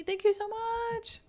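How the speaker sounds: high, slow, drawn-out thank you; sing-song thanks. Real, not acted. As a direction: beam it out like a cheerful sing-song. Streamer thanks donor in sing-song.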